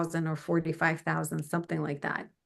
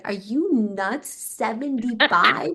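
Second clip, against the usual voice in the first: slow, rising, louder disbelief; can't believe he means it; drawn-out shocked question; incredulous; 4 semitones higher, 3 dB louder, slower than usual. Retold or imitated. Disbelief at renegotiating.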